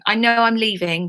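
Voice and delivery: normal talking voice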